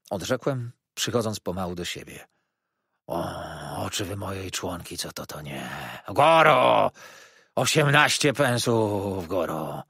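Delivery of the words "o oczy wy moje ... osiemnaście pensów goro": howling like wind. A narrator's reading. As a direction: haggle in long swelling wails. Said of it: "Każde słowo wymawiał na kształt wycia wiatru".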